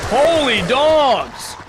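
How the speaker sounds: loud, high, stretched-out outcry; exclaiming. Real, not acted. Gamer startled by the game, loud drawn-out exclamation. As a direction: cry out big and slow.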